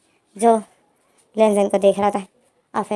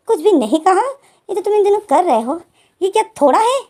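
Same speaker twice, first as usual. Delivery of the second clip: higher-pitched incredulous questioning; indignant retort; 8 semitones higher, 3 dB louder than usual. Retold or imitated. Wing challenging Lenzen's denial, indignant retort. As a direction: throw it back, rising in disbelief. Acted hurt retort in quarrel.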